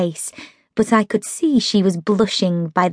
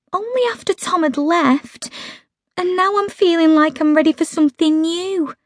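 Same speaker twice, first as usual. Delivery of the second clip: higher, hurt and reproachful; Meg's aggrieved voice; 8 semitones higher than usual. A narrator's reading. Meg complaining to Holly, wounded and higher. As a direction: voice Meg sulking at her unsympathetic friend.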